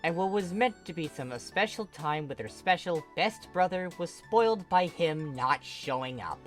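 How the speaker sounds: usual speaking voice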